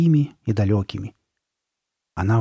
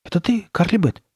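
put-on higher-pitched boy's question; uncertain boy; 8 semitones higher than usual. A narrator's reading. Boy to the masked girl, uncertain. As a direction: ask it high and unsure.

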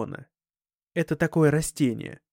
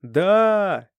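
loud, high pirate shout; shouted reply; 6 semitones higher, 7 dB louder than usual. A narrator's reading. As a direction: yell it down from the deck. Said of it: "крикнул один из пиратов".

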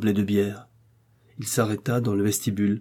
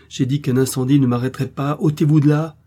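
fort et aigu, impérieux; l'écarter d'un cri; ordre furieux; 5 semitones higher, 6 dB louder than usual. Acted. Millicent pushes innkeeper aside. (Millicent ordonne à l'aubergiste de s'écarter, furieuse)

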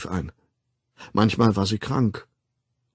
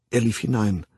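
plain reading voice

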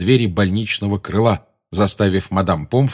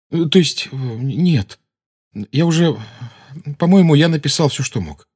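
lightly voiced boy, flustered; a hint of a confused boy; flustered Harry; 7 semitones higher than usual. A narrator's reading.

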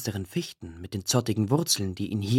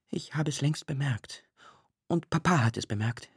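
subdued, mildly tearful; understated suppressed grief; holding back sobs; 2 semitones higher, 6 dB quieter than usual. A narrator's reading. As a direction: keep the tears in check. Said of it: "indem sie das Schluchzen zurückzuhalten suchte".

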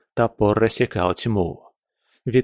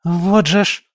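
exasperated gasp; 7 semitones higher, 4 dB louder than usual. A narrator's reading. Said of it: "только успела выдохнуть".

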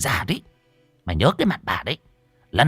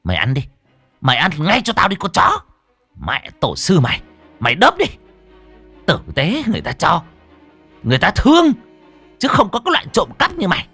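vicious commands climbing high; teeth-grinding rage; 9 semitones higher, 4 dB louder, faster than usual. A narrator's reading. Stall owner pushing the girl's face into spilled food, raging. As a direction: force out orders through gritted teeth, building higher. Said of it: "bà nghiến răng ken két".

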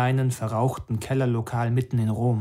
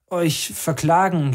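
slow, higher-pitched halting stammer; 5 semitones higher, slower than usual. A narrator's reading. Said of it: "stammelte ich".

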